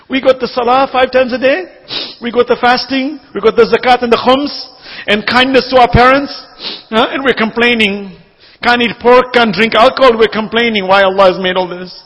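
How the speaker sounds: a preacher's rising cry; impassioned preaching. Acted. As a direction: drive each phrase home from the pulpit. Preaching at full force.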